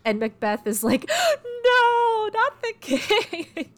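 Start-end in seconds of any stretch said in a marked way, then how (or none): 1.1-1.6 a big, high, hammed-up gasp of shock; dramatic gasp. Macbeth feigning shock at the king's death, a hammed-up gasp. Macbeth discovers the murdered king. 1.6-3.7 high, slow, hammed-up wail of dismay; mock horror. Macbeth pretending to mourn the king he killed, hammed-up high wail.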